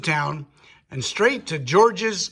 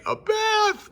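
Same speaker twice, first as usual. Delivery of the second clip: mock moan. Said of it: "moaned Spoonbelly".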